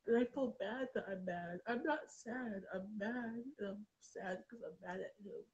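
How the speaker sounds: normal talking voice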